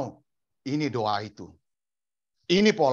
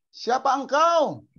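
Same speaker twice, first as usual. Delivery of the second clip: high, loud, slow-drawn question; startled Saul; 12 semitones higher, 5 dB louder, much slower than usual. Acted. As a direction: call out to an unknown voice. Acts Saul confronted by Jesus on road.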